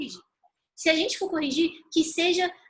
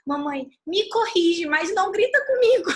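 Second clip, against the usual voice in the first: imitated pleading daughter; 5 semitones higher, 6 dB louder than usual. Mother voices daughter asking not to be yelled at, high childlike plea. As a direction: plead like a hurt child, getting higher and louder. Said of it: "ela virou pra mim e falou".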